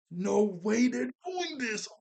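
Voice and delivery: high, slow, drawn-out outburst of disbelief; can't believe what the show just did; stunned disbelief. Retold or imitated. Viewer jumping up at a shocking scene, stunned disbelief. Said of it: "I was so upset".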